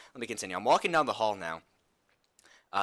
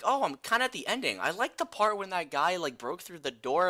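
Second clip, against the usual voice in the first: put-on much higher carefree kid voice; chirpy little kid; little brother; 7 semitones higher than usual. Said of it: "He's like".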